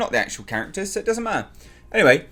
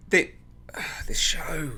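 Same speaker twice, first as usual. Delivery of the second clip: sighing, softer and slow; weary sigh; 3 semitones higher, 6 dB quieter, slower than usual. Real, not acted. Reviewer despairing at the show, tired sigh. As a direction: let the breath out in despair.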